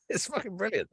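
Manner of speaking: high, soft laughing delivery. Real, not acted. Storyteller cracked up by his own anecdote, laughing. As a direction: squeeze the words out through a laugh.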